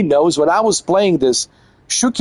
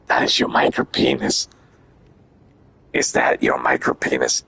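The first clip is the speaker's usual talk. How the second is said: creepy whisper; 3 dB quieter than usual. Pretend.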